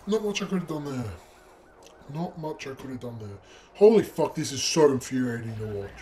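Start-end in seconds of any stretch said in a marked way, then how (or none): 2.1-3.4 softer resigned mutter; muttered repeat. Real, not acted. Gamer mutters it again to himself. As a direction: say it again under your breath. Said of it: "repeated more quietly".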